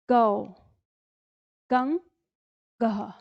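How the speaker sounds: each syllable ग, गा, गी, गु, गू spoken slowly and separately; barakhadi drill. Shown as a demonstration. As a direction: clear slow recitation of every ग syllable with pauses between. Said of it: "मेरे साथ-साथ दोहराते रहिएगा". (ग की बारहखड़ी धीरे-धीरे साफ़ उच्चारण)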